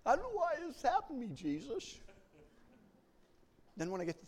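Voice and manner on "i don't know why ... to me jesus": quick self-pitying whine. Retold or imitated. Retold self-pity prayer.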